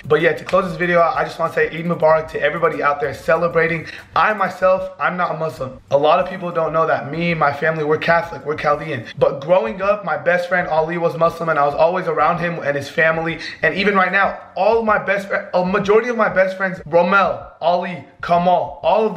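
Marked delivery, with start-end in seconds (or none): none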